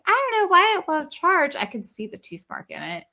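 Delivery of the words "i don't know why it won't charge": high, innocent little voice, pitched up; playing innocent. To her husband about the ear pod, high innocent voice. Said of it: "I'm like".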